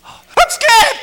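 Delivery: high, loud mock panic; hammed-up scared cry; mock fright. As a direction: blurt it out in comic fear. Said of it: "And you go".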